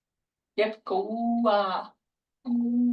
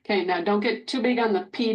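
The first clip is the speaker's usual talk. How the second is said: ordinary talk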